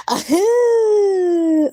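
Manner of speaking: put-on high hooting baby laugh; mock high baby hoot; imitating baby laugh. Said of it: "He would be like".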